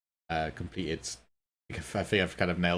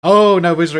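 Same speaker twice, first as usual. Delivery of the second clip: surprised; 10 semitones higher, 17 dB louder than usual. Real, not acted. Sees lava is explosions.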